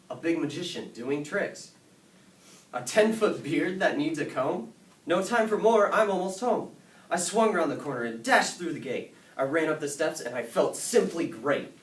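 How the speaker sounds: high, loud, breathless-with-news storytelling; excited rush home. A narrator's reading. Boy racing home with his tall tale, excited. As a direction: build the excitement loudly as the boy dashes home.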